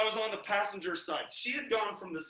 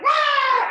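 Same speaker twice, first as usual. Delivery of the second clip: piercing high shriek; startled scream; 13 dB louder than usual. Retold or imitated. Storyteller imitating the girls' second fright, high scream. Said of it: "And they were like".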